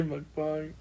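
"mukbang" ending in "bahng", said softly. Shown as a demonstration. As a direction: give "mukbang" an open AH in the last syllable. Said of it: "I say mukbang".

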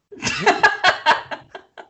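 high unvoiced burst of laughter; breathy laugh. Real, not acted. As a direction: break into laughing mid-story. Laughing at his own story.

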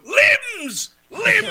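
very high, very loud shout; crowd yell. Acted. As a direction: yell it like a roaring soccer crowd. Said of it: "They just go".

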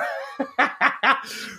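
high, airy laugh without voice; breathy laugh. Real, not acted. Guest recalling his naive boast, breathy laugh. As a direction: laugh under your breath at yourself.

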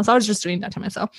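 ordinary talk, nothing marked

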